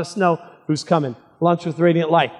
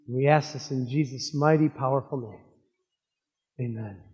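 prayer. Acted. Pastor leads opening prayer.

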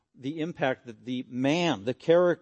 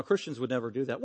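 ordinary talk

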